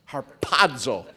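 "Harpazo" with a crisp, popped P; sharp P. Shown as a demonstration. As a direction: puff the P in "Harpazo". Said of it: "With a sharp P".

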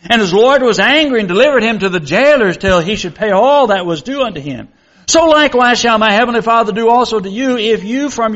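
measured reading of scripture to the congregation. Acted. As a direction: read the Bible passage aloud with solemn care.

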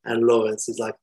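plain reading voice

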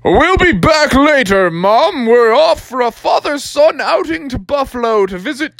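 high, jaunty farewell call; frog dad calling out. Acted. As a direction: call to Mom on the way out. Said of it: "Froggy's dad was like".

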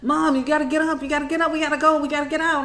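high, breathless-sounding urgent rush of pleas; frantic child begging mom to flee; imitating panicked kid. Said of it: "I heard them scream".

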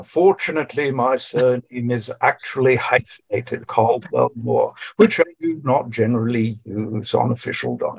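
put-on half-hearted British gentleman accent; fake posh British accent. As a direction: read it in a mock upper-class British accent. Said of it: "I'll put on the fake accent".

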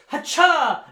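loud, high martial yelp; hammed-up battle cry; spell-casting cry. Imitating Hermione whipping round to cast, loud cry. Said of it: "she whips around and goes".

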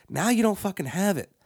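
usual speaking voice

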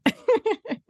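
bright high laugh; a quick delighted laugh. Real, not acted. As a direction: laugh along warmly. Host laughs with guest.

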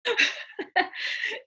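airy laugh; a quick breathy laugh; breathy high laugh. Real, not acted. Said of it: "and I'm like".

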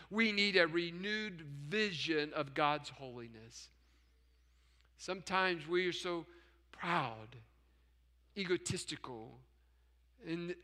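low, hushed, with long pauses; solemn admonition. Real, not acted. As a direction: bring it right down to a grave, soft confession.

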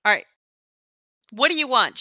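ordinary talk, nothing marked

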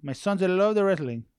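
high, loud imitation of Ozzy's accented English; mock Ozzy Guillen accent. Retelling Ozzy bringing his sons to the store, in mock accent. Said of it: "He goes".

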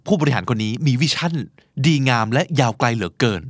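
usual speaking voice

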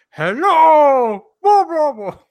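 high, loud puppet call with a drawn-out hello; sock puppet voice. Pretend. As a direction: play a silly sock puppet calling out. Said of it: "give your sock puppet a, a weird accent".

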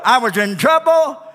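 ordinary talk

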